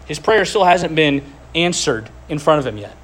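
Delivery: ordinary talk, nothing marked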